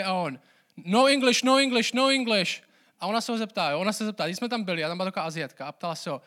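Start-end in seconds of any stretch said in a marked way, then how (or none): 0.8-2.6 higher, louder repeated brush-off; imitated angry shouting. Grumpy bus driver to a foreign passenger, imitated angry shouting. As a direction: shut the tourist down, like an irritated driver. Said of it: "tak on na něj křičí všechno".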